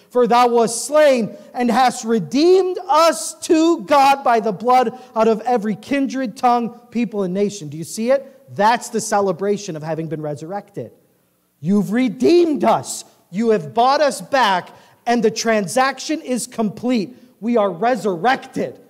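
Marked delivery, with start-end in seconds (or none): 0.1-7.7 high, loud preaching cadence; full-force scripture reading. Acted. 11.6-18.8 high, loud and slow, leaning on redeemed; slow emphatic preaching. Acted.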